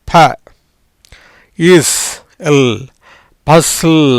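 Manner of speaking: syllables ப, இஸ், எல் spaced apart, then joined as பசில்; sounding out. Shown as a demonstration. Teacher sounds out பசில் piece by piece for the class. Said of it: "எப்படி?".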